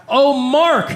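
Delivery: ordinary talk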